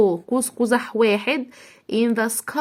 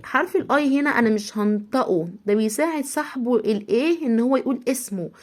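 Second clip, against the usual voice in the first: normal talking voice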